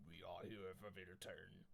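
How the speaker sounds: low, soft, slow villain voice; a hushed threat from the assassin leader; low slow assassin growl of a demand; menacing ninja. Acted.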